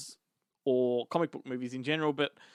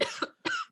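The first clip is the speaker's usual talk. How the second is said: choking cough. Real, not acted. Drink went down wrong way.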